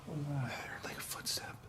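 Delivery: tense breathy whisper. Real, not acted. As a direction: whisper as if someone listens. Heard a footstep in the dark.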